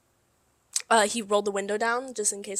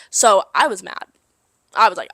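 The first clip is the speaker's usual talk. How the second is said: ordinary delivery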